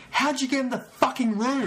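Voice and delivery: high, loud disbelief; a mock-baffled cry of disbelief; incredulous. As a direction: ask it like you can't believe your ears. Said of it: "I just went".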